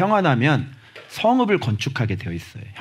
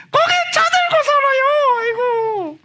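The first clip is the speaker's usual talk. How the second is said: far higher, loud comic squeal; comic high shout; 3 dB louder than usual. Acted. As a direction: squeal it out for a laugh. Shrill comic exhortation.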